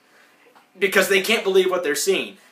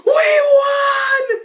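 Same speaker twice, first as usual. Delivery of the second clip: much higher, much louder victory shriek; ecstatic scream; 8 dB louder than usual. Retold or imitated. Winning the film festival, loud high victory scream. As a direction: scream it in sheer triumph. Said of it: "Filbert's like".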